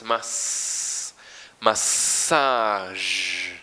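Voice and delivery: Mas- whispered, then massage with a long buzzing zh; massage pronunciation. Shown as a demonstration. Teacher asks the class to copy massage, split first. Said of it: "Please really try it like this".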